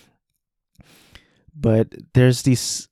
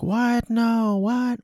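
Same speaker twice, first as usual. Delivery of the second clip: much higher, shocked cry of disbelief; shocked fans; 13 semitones higher, much slower than usual. Retold or imitated. Fans at the concert reacting to Mima's news, shocked high cry. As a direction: play a stunned fan crying out in protest. The audience is like.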